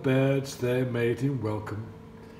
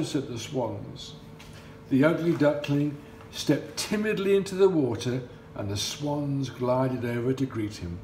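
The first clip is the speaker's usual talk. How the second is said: ordinary delivery, nothing marked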